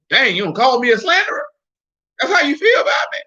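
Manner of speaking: high-pitched hurt protest; mock offended. Acting offended devil answering the caller.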